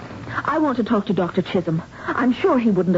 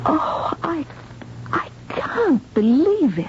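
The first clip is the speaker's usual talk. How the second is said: shocked disbelief; 8 semitones higher, slower than usual. Acted. Hears patients kept dead on books.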